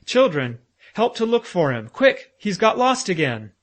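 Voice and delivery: put-on loud, high alarmed call to the children; urgent call. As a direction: raise the alarm, he's lost. Professor calls children to search.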